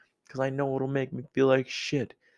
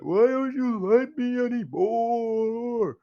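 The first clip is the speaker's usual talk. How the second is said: very high, drawn-out hammed-up wail; mock scream; 13 semitones higher, 4 dB louder, slower than usual. Texter misreading a message confronts the other person, mock screaming. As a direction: scream it slowly like a wounded, needy texter. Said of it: "fucking scream at the other person".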